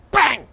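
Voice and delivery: loud high shouted bang; gunshot imitation. Retelling the shootout, loud shouted gunshot sound.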